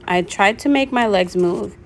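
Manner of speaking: plain reading voice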